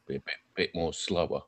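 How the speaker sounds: phone voice. Shown as a demonstration. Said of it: "deeper and a bit more slow".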